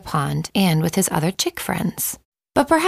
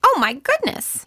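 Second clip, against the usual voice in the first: much higher, excited chick cry; panicked cry; 13 semitones higher than usual. Acted. Chicken Little alone under the tree, a high alarmed cry. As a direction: squeak it out in sudden alarm. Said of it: "he shouted".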